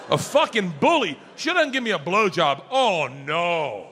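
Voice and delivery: ordinary talk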